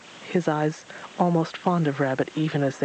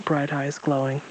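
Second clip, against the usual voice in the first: ordinary delivery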